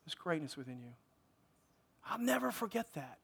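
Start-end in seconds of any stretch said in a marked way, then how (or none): 0.1-0.9 much softer, quietly recalling words spoken to him; hushed recollection. Retold or imitated. Pastor softly recalling encouraging words spoken over him, hushed. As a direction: let the words sink in softly, like a memory.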